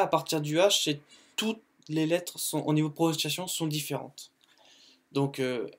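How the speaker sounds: ordinary delivery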